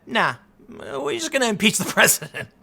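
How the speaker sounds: a put-on dismissive voice, laughing through the word; mocking dismissal. Democrats brushing off moderates, mocking laughing voice. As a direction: sneer their answer back at them with a laugh.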